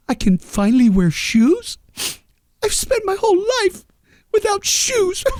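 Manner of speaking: lightly voiced monster, going very high with a mock sob; hammed-up Godzilla near tears over shoes; a hint of a weepy monster. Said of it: "Godzilla's like".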